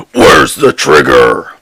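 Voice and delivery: slow, loud gravelly growl; Batman voice. Acted. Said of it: "That's Batman".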